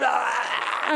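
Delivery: wordless ranting growl trailing off the tirade; mock livid "aah". Livid resident loses words at the visitors, mock raging noise. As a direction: imitate a furious man's garbled outburst. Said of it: "he's livid again".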